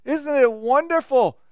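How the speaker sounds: high, slow gushing delight; mock gushing over a grand stadium. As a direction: coo over it like a starstruck tourist. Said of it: "And you gush".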